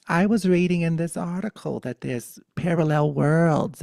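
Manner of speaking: high, loud animated grandma; Italian granny. Retold or imitated. Grandmother telling grandchild about an article, high and loud. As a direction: announce it as an excited little granny. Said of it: "A little, little Italian granny Buddha".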